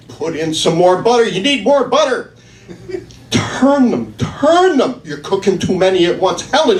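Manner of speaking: high, loud, frantic backseat-cook nagging; nagging husband. Retold or imitated. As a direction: pester her like a panicking passenger. Husband nagging wife over cooking.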